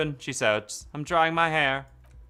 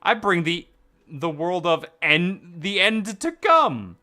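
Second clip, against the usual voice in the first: slow ominous; 3 semitones higher than usual. Acted. Ominous in-character line.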